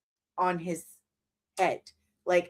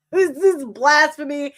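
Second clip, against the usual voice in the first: laughing; 3 semitones higher, 6 dB louder than usual. Real, not acted. Repeats with a laugh.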